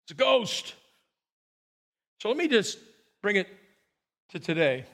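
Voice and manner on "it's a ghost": higher-pitched mock fearful cry; mild, acted alarm. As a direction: act out a frightened exclamation. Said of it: "They cried out in fear".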